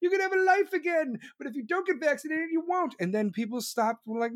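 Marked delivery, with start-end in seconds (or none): 0.0-2.9 high, hammed-up Oprah giveaway voice; mock Oprah handing out prizes. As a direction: shout out gifts like a gushing talk-show host. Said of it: "Be like fucking Oprah".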